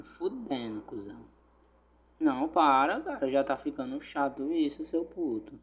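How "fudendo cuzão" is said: muttered curse; cursing under breath. Real, not acted. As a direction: grumble it under your breath. Irritado, fala baixinho. (xingando baixinho)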